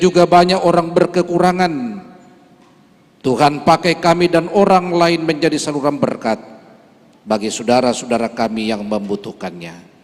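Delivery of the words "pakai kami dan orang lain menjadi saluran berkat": ordinary delivery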